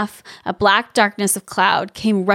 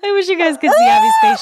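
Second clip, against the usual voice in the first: laughing; 4 dB louder, faster than usual. Real, not acted. Laughing at co-host's reaction.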